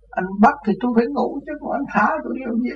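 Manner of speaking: high, teasing talk-back to the police; mock the officer playfully; cheeky retort. (cãi lại công an giọng trêu chọc cao)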